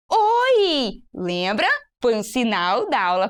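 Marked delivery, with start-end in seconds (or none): 0.1-1.0 high bright hi; cheerful hi. Shown as a demonstration. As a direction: call out a happy hi. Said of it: "em Libras, vai ficar". (oi animado)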